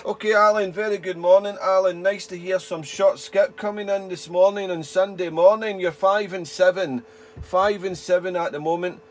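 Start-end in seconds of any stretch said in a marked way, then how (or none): none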